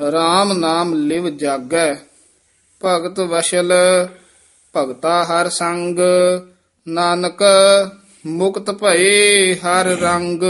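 measured, sustained Gurbani recitation; scripture recitation. Shown as a demonstration. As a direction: recite phrase by phrase, holding the endings.

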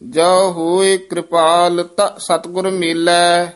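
measured chant with held line endings; scripture recitation. Acted. Teacher models Gurbani for students, measured chanted recitation. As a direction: recite evenly, pausing between phrases.

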